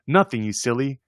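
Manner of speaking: usual speaking voice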